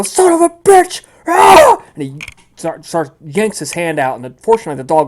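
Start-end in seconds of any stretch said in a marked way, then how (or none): 0.0-1.0 high, loud acted curse; pained cursing yell. Game master as thug bitten by a dog, pained yell. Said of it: "you hear him go". 1.3-1.8 high, very loud acted cry of pain; pained scream. Game master as thug with bitten hand, screaming in pain. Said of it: "you hear him go".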